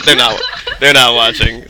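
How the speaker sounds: laughing through the words. Real, not acted. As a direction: giggle then talk while laughing. Laughs about streaming it.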